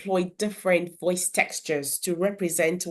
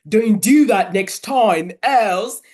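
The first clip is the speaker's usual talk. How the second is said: much louder, slow, gruff monster warning; gruffy Gruffalo voice; 8 dB louder, slower than usual. Shown as a demonstration. Parent voicing the Gruffalo to children, loud slow gruff warning. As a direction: boom it like a big grumpy beast. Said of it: "And the Gruffalo say".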